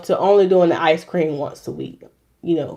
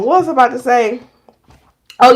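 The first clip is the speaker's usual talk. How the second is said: ordinary delivery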